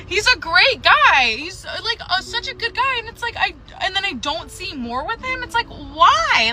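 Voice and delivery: high, tearful pleading voice; real upset, pitch climbing and wavering; getting emotional. Real, not acted. Frustrated woman venting about the guy she's seeing, emotional. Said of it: "I don't know why I'm getting emotional".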